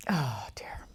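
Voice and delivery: hushed, rueful oh dear; breathy oh dear whisper. Real, not acted. Actress trailing off about the brain-exploding pen, whispered. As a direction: let it out under the breath.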